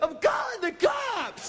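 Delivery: put-on very high, drawn-out girlish shriek; mock-terrified high squeal; screaming little girl. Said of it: "I screamed like a little girl".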